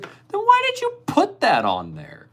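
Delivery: very high squeaky disbelief; incredulous. Retold or imitated. Teller shrieks at his friend over the gift list, incredulous. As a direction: shriek the question in mock outrage.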